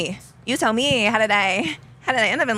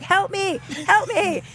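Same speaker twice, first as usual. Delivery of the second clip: 1 mock cry for help; 12 semitones higher, slower than usual. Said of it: "I'm like".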